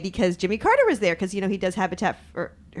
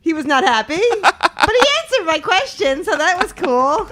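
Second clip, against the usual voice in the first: laughing; 10 semitones higher, 5 dB louder than usual. Real, not acted. Laughing over the awkward outcome.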